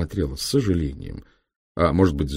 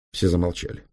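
ordinary delivery, nothing marked

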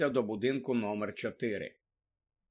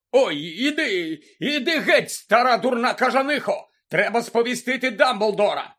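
high, loud, harried shout; irate protest; 6 semitones higher, 6 dB louder than usual. A narrator's reading. Lookout being hit by old woman, irate. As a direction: yell it while fending off blows. Mundungus fends off a beating.

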